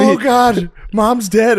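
high, loud panicked imitation; hammed-up cry of alarm; mock panic. Voicing Katie finding mom collapsed.

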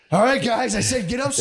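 put-on high, loud snap at the kids; mad parent yell. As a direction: lose your temper at dawdling kids. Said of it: "You get so fucking mad".

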